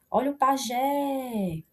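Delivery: slow call with a long stressed "jé" in "pajé"; stretched last syllable. Shown as a demonstration. Teacher giving an example ending in E, stretched last syllable. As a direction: draw out the final "jé" of "pajé". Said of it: "esse macete que eu tô fazendo aqui".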